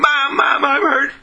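high-pitched wailing child, mildly acted; mock child's holler, no real scream; hollering hurt child. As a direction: cry out high like a hurt kid calling mother. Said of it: "hollering and screaming".

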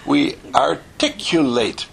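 slow "articulate", syllable by syllable; "articulate" in slow, deliberate enunciation; carefully articulated. Shown as a demonstration. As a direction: draw out and fully sound each syllable of "articulate". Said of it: "speak the words completely".

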